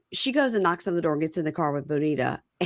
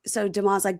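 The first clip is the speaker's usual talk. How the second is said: ordinary delivery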